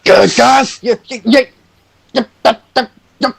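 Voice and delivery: high, frantic yelling in a put-on voice; panicked screaming Hercule. The rogue screams at the party about the burning sky, high and panicked. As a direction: shriek it like the sky is falling. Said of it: "Hercule begins screaming".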